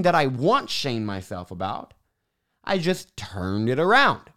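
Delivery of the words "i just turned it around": ordinary talk, nothing marked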